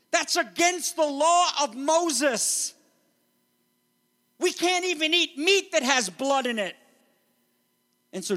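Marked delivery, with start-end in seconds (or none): 0.1-6.8 higher, slower outraged objection; indignant crowd. Retold or imitated. Crowd objecting to Jesus, higher and outraged. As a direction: protest like a shocked law-keeper. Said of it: "They're like".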